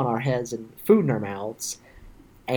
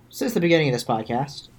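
ordinary delivery

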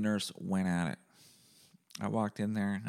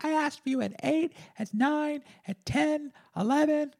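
very high-pitched imitation of the nurse; hammed-up high nurse voice; mock indignant nurse. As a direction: list it off in a squeaky, accusing put-on voice. Acts out the nurse's accusation.

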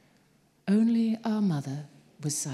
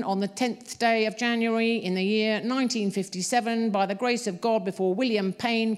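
droning official monotone; 6 semitones higher than usual. A narrator's reading. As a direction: read it like a bored court clerk. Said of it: "started speaking in a monotone".

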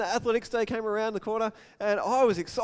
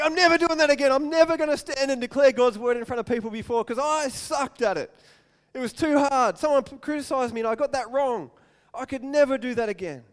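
louder and higher, hurt and swearing off; discouraged vow; 6 semitones higher, 6 dB louder than usual. Retold or imitated. Failed young preacher swearing never to preach again, hurt vow. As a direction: declare you're done for good.

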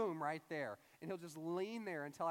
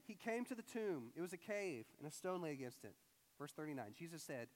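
ordinary talk

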